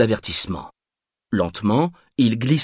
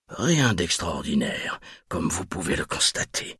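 murmured; 4 semitones lower, 7 dB quieter than usual. A narrator's reading. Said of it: "murmura-t-il".

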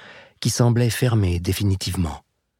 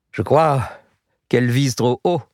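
teasing; 3 semitones higher than usual. A narrator's reading. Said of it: "se moqua Nagare".

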